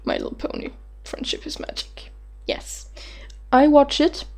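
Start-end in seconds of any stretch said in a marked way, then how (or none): 0.1-2.9 confessional whisper. Shown as a demonstration. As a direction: whisper it like a secret. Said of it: "Gonna say it like this".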